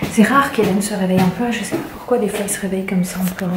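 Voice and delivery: ordinary delivery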